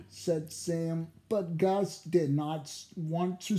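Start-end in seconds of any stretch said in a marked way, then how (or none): none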